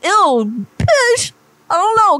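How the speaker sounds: a high, squealing "Ew, bitch" of mock disgust; disgusted shriek. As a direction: recoil from the gross question with a shriek. Disgusted at a crude question.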